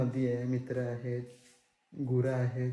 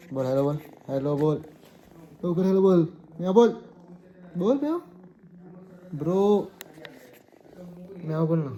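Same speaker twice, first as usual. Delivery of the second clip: raised, high-pitched commands to a pet; loud coaxing; 4 semitones higher, 9 dB louder than usual. Real, not acted. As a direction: call out insistently, egging it on. Urging pet to say meow. (मोठ्या, चढ्या आवाजात आग्रह)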